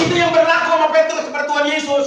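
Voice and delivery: loud, high, rapid burst; emphatic exclamation. Real, not acted. Preacher applying the story to Petrus, emphatic exclamation. Preacher drives home the comparison.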